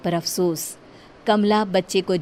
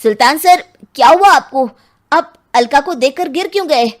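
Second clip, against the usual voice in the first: loud, higher, urgent questions; alarmed questioning; 6 semitones higher, 11 dB louder than usual. Acted. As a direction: ask in alarm after someone collapses. Officer alarmed at Sultan's collapse.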